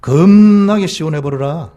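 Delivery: loud, drawn-out Jeolla dialect; slow, emphatic Jeolla demo. Shown as a demonstration. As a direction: stretch it out big in Jeolla accent. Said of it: "전라도".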